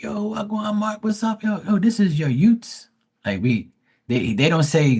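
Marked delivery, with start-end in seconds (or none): 0.0-2.9 high-pitched Bermudian street greeting. Retold or imitated. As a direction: imitate a friend's slangy, excited hello.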